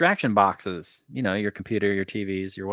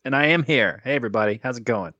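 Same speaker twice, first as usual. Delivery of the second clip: normal talking voice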